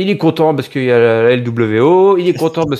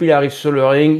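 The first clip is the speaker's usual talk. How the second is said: usual speaking voice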